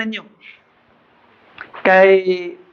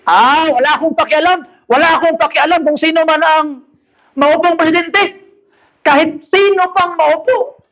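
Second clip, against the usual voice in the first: higher-pitched mock indifference, stretched at the end; imitated defiant interviewee; 7 semitones higher than usual. Man answering an interviewer about presidents, mock indifference. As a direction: brush off the question in a raised voice. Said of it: "Gi-interview niya ako sa kataong".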